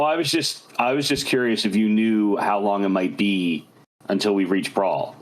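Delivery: usual speaking voice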